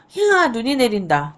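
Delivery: usual speaking voice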